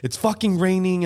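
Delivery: mock angry yell. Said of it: "I was just so angry".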